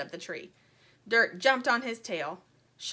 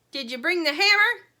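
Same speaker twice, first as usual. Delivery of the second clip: squirrel voice; 8 semitones higher, 10 dB louder than usual. A narrator's reading. Squirrel asks chicks for hammer.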